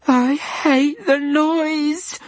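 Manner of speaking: very high, very slow whimper. A narrator's reading. Diana whimpering over the noise. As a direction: whimper it into the silence. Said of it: "whimpered Diana".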